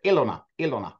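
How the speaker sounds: "Ilona" said EE-lo-na, falling; "Ilona" as EE-lo-na, plainly demonstrated; demonstrated pronunciation. Shown as a demonstration. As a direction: show "Ilona" with stressed first EE and a clear L. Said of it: "How do you pronounce it".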